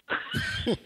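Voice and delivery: high, quiet giggle; soft laugh. Real, not acted. Guest teases the hosts as clowns, soft laugh. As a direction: let out a small high giggle.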